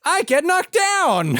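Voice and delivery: very high, slow nasal scream; hammy director shouting lyrics at the cast. Acted. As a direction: holler it over the interruption, stretched out. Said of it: "screams tub thumping lyrics at the cast".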